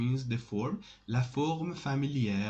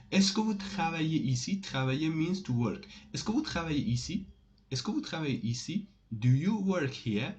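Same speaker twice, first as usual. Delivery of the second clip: ordinary talk, nothing marked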